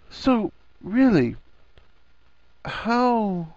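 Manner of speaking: slow down and leave it hanging; halting question that peters out; voice trailing off. A narrator's reading. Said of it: "as his voice trailed off".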